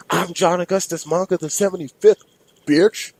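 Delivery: high, loud monster scream; screaming Minotaur. Acted. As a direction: shriek it like a raging bull-man. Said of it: "The not-so-mini Minotaur screamed".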